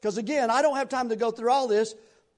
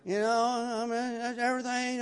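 mild mopey Eeyore whine, softer; Eeyore-like moping; 4 dB quieter than usual. Retold or imitated. Pastor mocking false humility, mild Eeyore mope. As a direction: sigh it out like a gloomy donkey. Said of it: "Eeyore type of".

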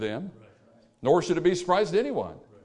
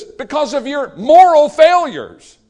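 much higher and louder, slow; pronounced judgment; 9 semitones higher, 11 dB louder, slower than usual. Acted. Preacher as God, crying out the cause to the people. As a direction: ring out each word of the judgment.

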